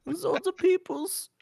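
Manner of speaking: put-on high, lightly accented Toki voice. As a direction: squeak it out like Toki. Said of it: "sounds just like Toki".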